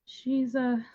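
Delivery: slow, halting, monotone reply; dissociated character. Acted. Character explaining a lost relative when asked, halting monotone. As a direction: answer haltingly, with long empty gaps. Plays shaken character answering questions.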